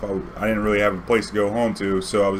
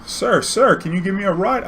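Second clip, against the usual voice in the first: higher-pitched imitation of an old lady; little old lady; 9 semitones higher than usual. Old lady asking a cab driver for a ride, imitated. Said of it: "this little old lady standing outside".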